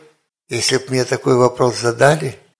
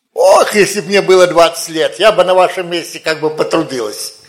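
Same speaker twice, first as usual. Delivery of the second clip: loud, slightly raised mock lament; wishful lament; 3 semitones higher, 7 dB louder than usual. Old believer wishes she were twenty, mock wishful lament. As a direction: lightly mimic a wistful complainer, loudly. Mimics an older woman's wishful sigh.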